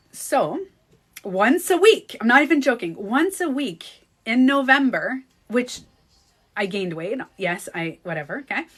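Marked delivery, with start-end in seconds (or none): none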